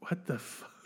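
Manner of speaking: barely voiced whisper, trailing away; mouthed, baffled reaction. Imitating the actor's reaction in the scene, whispered. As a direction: whisper it under your breath in mock disbelief. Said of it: "Where he's just like".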